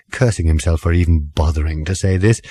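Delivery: ordinary delivery, nothing marked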